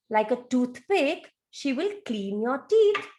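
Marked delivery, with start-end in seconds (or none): none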